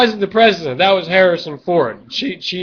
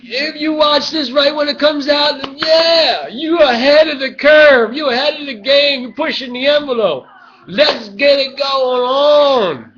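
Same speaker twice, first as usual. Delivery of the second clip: hype pitch; 8 semitones higher, 5 dB louder than usual. Acted. Hyping his show to the viewers, high loud shouting. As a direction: pump the viewers up like a carnival barker.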